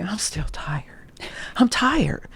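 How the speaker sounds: whispered, worn-out sigh of words; tired whisper. Retold or imitated. Complaining of exhaustion to herself, in a worn whisper. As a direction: barely get the words out, exhausted.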